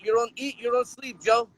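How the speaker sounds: ordinary delivery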